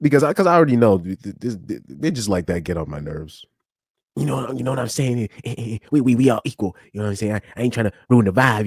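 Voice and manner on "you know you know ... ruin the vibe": lightly mocking impression of a guy who won't talk normally; mocking his manner of talk. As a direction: parrot him back with a stammering, mocking lilt. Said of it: "please talk normally".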